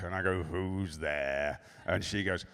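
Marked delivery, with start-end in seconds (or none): 0.4-1.6 low, quiet, weary play-along; indulgent dad. Retold or imitated. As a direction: ask with dutiful parental patience. Dad plays along wearily.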